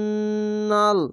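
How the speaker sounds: আন্নাল with a long held nasal on the doubled ন; held ghunna. Shown as a demonstration. Teacher showing the compulsory ghunna, slow recitation. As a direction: stretch the ন in আন্নাল into a nasal hum. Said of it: "ওয়াজিব গুন্না".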